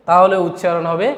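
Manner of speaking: normal talking voice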